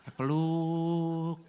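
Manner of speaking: very slow, stretched-out word; one long sustained vowel on "peluk"; drawn-out hug. Retold or imitated. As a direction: draw out "peluk" as if holding a long embrace.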